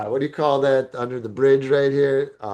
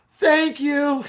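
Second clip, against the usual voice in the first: retold yell; 12 semitones higher than usual. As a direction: shout thanks from upstairs. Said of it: "while yelling out his window".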